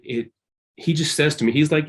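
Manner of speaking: ordinary talk, nothing marked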